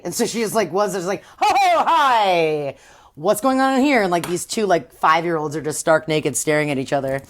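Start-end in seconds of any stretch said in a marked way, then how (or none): 1.4-4.0 slow, drawn-out nervous hi; awkward mock greeting. Dawn walking in on the naked kids, slow awkward hi. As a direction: stall while walking into the room.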